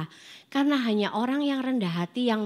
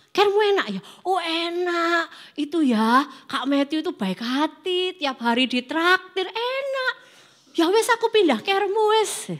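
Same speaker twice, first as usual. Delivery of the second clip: gushing gossip; 7 semitones higher than usual. Retold or imitated. Gossiping youths about switching groups.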